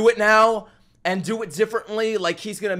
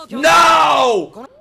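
shout; 9 semitones higher, 9 dB louder than usual. Real, not acted. Yelling at screen in horror.

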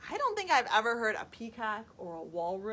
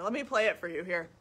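ordinary talk

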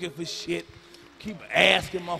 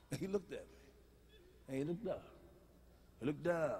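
hushed suspense; 5 semitones lower, 11 dB quieter than usual. Acted.

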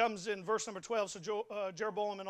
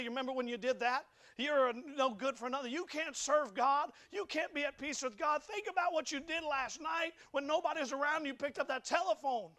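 raised-pitch, insistent nagging accusation; relentless accuser, pitched up and pressing; accusing devil; 4 semitones higher than usual. Retold or imitated. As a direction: voice the tempter heaping guilt on someone. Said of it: "The devil likes to take that guilt".

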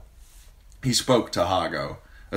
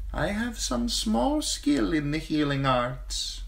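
slave woman's voice; 13 semitones higher, slower than usual. Acted. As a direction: offer help meekly. Healer woman.

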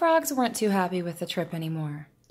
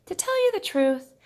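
much higher rooster voice; rooster confiding; 12 semitones higher, 4 dB louder than usual. Acted. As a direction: open up to the moon as the rooster.

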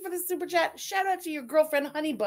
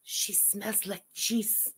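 low, soft, slow confiding; mock camel whisper; 6 semitones lower, 8 dB quieter, much slower than usual. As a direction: pretend to whisper camel gossip, dragging it out. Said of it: "The one camel whispers to the other camel".

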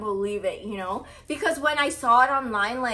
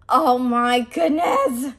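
normal talking voice

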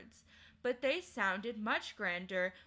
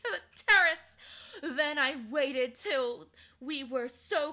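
triumphant queen; 5 semitones higher, 7 dB louder than usual. Acted. Queen to the children recalling her war, loud and grand. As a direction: relish every word of the slaughter.

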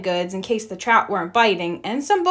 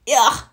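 a short, loud, raised grunt of disgust; disgusted ugh; 3 semitones higher, 5 dB louder than usual. A narrator's reading. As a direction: spit out a yuck at the taste. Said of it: "said Sister".

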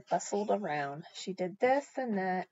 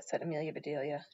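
usual speaking voice

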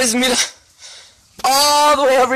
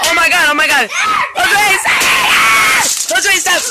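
loud high excited yelling; excited shout; 5 semitones higher, 5 dB louder, faster than usual. Real, not acted. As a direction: shout with delight as candy spills. Excited at candy bursting out.

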